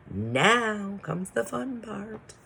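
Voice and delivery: high, drawn-out teasing announcement; playful excitement. Real, not acted. Teacher introducing the fun activity to pupils, playfully excited. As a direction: build fun anticipation, slow and high-pitched.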